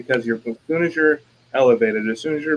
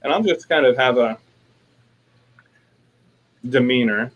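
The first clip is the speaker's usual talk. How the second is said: ordinary talk, nothing marked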